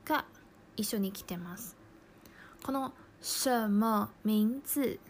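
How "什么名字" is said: 什么名字 said slowly, each syllable clear; slow clear Chinese. Shown as a demonstration. Teacher to Japanese learners, slow clear Chinese model. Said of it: "は、".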